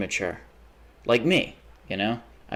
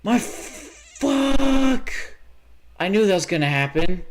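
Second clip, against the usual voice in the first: much higher and louder, aghast; shocked outburst; 11 semitones higher, 4 dB louder, slower than usual. Real, not acted. Streamer reacting to in-game deaths, shocked. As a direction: react in horror.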